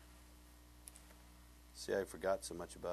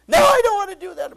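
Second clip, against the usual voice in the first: very high, very loud tantrum scream; mock arguing back; 23 dB louder than usual. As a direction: shriek the refusal like an overacted child. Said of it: "This big argument is going on".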